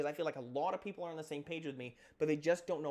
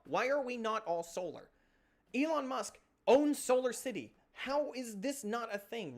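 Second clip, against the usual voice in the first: heated rant; 6 semitones higher, 3 dB louder than usual. Real, not acted. Ranting about solar power.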